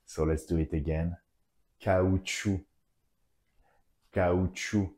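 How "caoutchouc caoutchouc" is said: "Caoutchouc" with the final c silent; French pronunciation. Shown as a demonstration. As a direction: say "Caoutchouc" as ka-oo-tchoo, modelled for learners. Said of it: "Final consonants are not pronounced".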